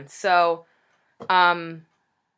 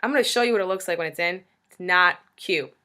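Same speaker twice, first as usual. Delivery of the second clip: ordinary delivery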